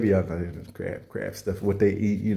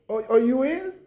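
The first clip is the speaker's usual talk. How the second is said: high falsetto surprise; delighted high-pitched surprise; surprised squeal. Pretend. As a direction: squeak it out, caught off guard. Surprised at party invite.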